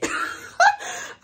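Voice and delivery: high breathless laugh; burst of laughter. Real, not acted. Laughing at herself over rereading Outlander. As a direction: crack up at yourself, airy and high.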